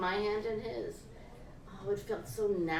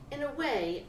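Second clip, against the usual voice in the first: plain reading voice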